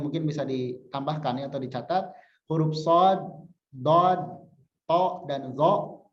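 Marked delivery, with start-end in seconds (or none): 2.5-6.0 sod, dod, to and zo said thick and strong; heavy emphatic letters. Shown as a demonstration. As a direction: give each of sod, dod, to and zo a full, heavy sound. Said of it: "Itu ketika kasroh tetap kuat".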